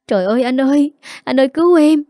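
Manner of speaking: high, loud, panicked crying plea; crying out for help. A narrator's reading. Wife begs husband for rescue, panicked crying cry. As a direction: wail for your husband to save you. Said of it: "khóc òa".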